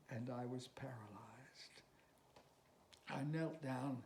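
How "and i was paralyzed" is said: hushed breathless whisper; whispered dread. Acted. Old tightrope walker confessing his fear to Marette in a whisper. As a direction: breathe it out as if still frozen.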